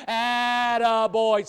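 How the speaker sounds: "Attaboy" called out loud and slow. Shown as a demonstration. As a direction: cheer "Attaboy" loudly to your neighbour. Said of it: "Do it right now".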